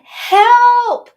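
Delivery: high, loud drawn-out yell; retold cry for help. Going back down in the water, loud high cry for help. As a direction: yell it long and high, re-enacting a plea for rescue.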